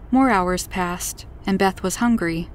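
plain reading voice

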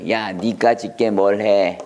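low, slow scornful taunt; mocking. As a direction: sneer at someone as a nobody. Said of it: "하고 막 조롱하는 거예요". (낮고 느리게 깔보는 조롱 투)

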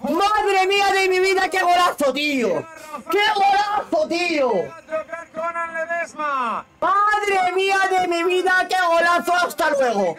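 thrilled goal celebration, high pitched; breakneck goal commentary. Real, not acted. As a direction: keep raving about the goal.

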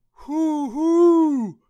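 very high, very loud owl hoot; angry owl hoot. A narrator's reading. Owl disturbed by Douglas, loud angry hooting. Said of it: "said the owl angrily".

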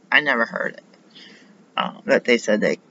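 ordinary delivery

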